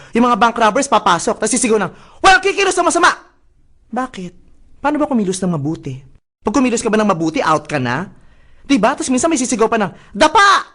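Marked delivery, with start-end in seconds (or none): 2.2-3.1 mock robber shout. 10.1-10.7 short robber's yell, a bit louder; mock shout. Robber yelling get down.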